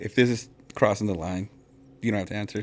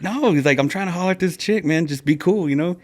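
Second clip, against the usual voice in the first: high-pitched imitation of a protesting buddy; pleading refusal; 7 semitones higher than usual. Buddy chasing a girl begs him to stay, high-voiced. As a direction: protest in a raised voice. Acts out friend refusing to leave.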